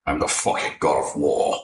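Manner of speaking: low, gruff god-of-war boast; mock Kratos. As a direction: boom it out deep like a war god. Kratos impression line.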